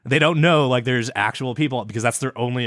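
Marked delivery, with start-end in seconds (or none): none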